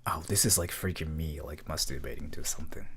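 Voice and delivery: very low rumbling voice; deep bass voice. Pretend. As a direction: drop to the lowest voice you can. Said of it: "the lowest voice I can do".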